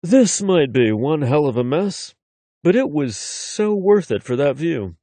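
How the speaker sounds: seductive man's voice in her ear; a hint of a sultry lover; sexy voice. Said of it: "Shay's sexy voice in my ear".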